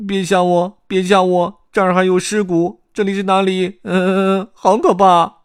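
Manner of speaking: high, loud, scared wailing; 吓坏的贝利奥. A narrator's reading. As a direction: whimper and plead in a high frightened voice. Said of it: "贝利奥吓得脸色苍白". (高声害怕、哀叫求饶)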